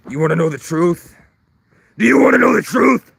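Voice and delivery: high demanding shout. Pretend. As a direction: yell the challenge. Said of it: "And I go".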